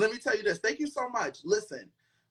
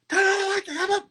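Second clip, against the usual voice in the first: mock frantic call; 11 semitones higher, 4 dB louder than usual. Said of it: "my friend will call and be like".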